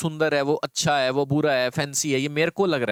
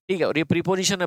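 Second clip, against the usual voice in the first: ordinary delivery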